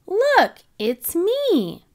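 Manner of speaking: a little sheep proudly showing off her photo; excited Susie. Acted. Susie shows Peppa her baby photo, squealing with delight.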